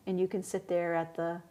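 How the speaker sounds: ordinary talk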